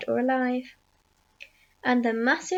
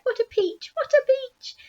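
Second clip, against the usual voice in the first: high jubilant exclamation, rising higher; excited shout; 12 semitones higher, 4 dB louder than usual. A narrator's reading. Aunt delighting in the huge peach, high exultant shout. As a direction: cheer it, higher the second time. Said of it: "Aunt Spiker shouted".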